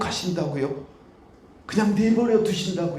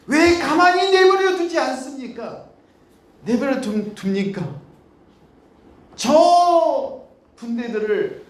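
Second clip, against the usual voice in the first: pleading outcry; 8 semitones higher, 3 dB louder than usual. Acted. Ahab's pleading prayer voiced.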